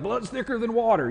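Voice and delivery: high-pitched Texas twang; Texas accent. Acted. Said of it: "forgive me for that accent".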